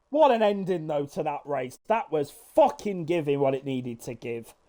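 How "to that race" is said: normal talking voice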